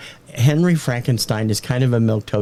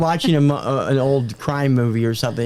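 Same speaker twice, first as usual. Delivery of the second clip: ordinary talk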